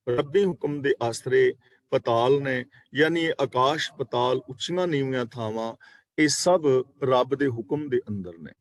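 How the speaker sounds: normal talking voice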